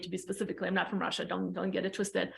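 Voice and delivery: quick low-voiced clarification, off to the side; muttered aside. Real, not acted. Side clarification about her origin.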